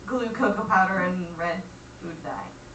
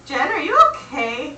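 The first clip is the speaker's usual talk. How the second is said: a little louder, mock urgent mom; all-caps message read aloud; 6 semitones higher, 8 dB louder than usual. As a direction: read the caps like a worried mother's call. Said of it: "And it's all in caps".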